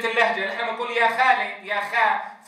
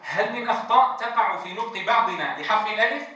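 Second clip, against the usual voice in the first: ordinary talk, nothing marked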